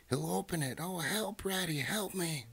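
high, wailing mouse voice; panicked plea. A narrator's reading. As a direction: beg for rescue in a high squeal. Mouse begs as badger knocks.

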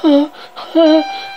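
high shuddering breaths. Acted. Reader voices the captioned shudder, high shuddering gasps.

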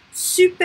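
Super high and loud, French R at the end; bright-pitched, emphatic Super keeping its final R; final R pronounced. Shown as a demonstration. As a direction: exclaim Super as "sü-pair", R sounded. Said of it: "we have to pronounce that R".

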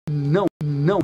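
normal talking voice

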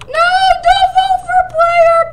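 high, loud plea; high loud pleading cry; pleading shout. Real, not acted. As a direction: beg them not to vote, high. Pleading loudly in game vote.

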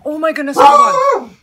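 sudden loud, high alarm; startled outburst. Real, not acted. Vlogger startled by her cat's chaos, loud yelp. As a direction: yelp as the house crashes.

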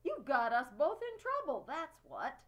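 loud, cross outburst at a friend; boy scolding his friend crossly. A narrator's reading. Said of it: "Then he said crossly".

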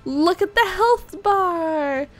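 high, drawn-out whine of injustice; wailing protest. Real, not acted. Player denied credit for the fight, high wailing protest. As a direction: cry foul, stretching the words out.

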